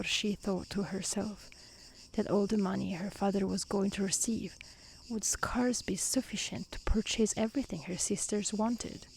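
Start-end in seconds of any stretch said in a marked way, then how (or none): none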